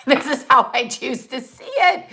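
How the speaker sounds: amused, laughter breaking into the words; laughing. Real, not acted. Speaker defending her odd belief to viewers, laughing. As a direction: talk through a laugh.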